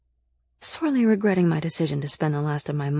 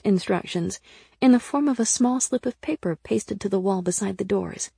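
ordinary delivery

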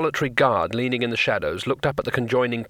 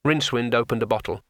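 ordinary delivery

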